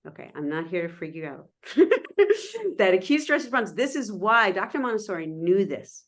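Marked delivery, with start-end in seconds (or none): 1.7-2.7 high giggling laugh; light laugh, pitched way up. Real, not acted. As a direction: giggle after reassuring them. Laughs after reassuring audience.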